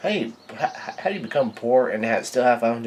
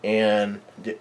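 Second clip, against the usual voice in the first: ordinary talk, nothing marked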